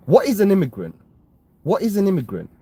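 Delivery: indignant. Real, not acted. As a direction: fire the question back in real outrage. Heated rant at racist chatter.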